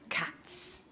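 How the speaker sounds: whispered reveal. A narrator's reading. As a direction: hush it like a secret. Overwhelming smell reveal.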